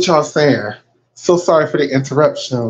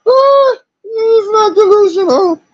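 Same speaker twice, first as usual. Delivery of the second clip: high-pitched insisting; slower than usual. Real, not acted. Insisting, repeats it louder.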